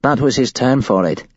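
a hint of a scared young woman; shaken woman witness. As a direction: voice her as a rattled witness telling it. Woman recounts the cult's plan.

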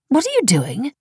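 surprised question. A narrator's reading. Wife finding husband at his laptop late, surprised. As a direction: ask it high, caught off guard.

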